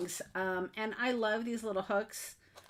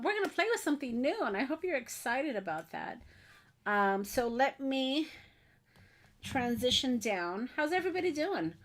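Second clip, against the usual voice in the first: ordinary talk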